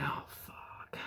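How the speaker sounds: breathy whispered "Oh, fuck"; a scared whisper under her breath; whispered curse. Retold or imitated. In the dark woods, whispering to herself in fright. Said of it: "I was like".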